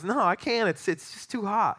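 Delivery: high-pitched imitated pleading; mock distressed protest. Imitating English friend refusing hot sauce, mock distressed. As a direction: play a friend overwhelmed and refusing.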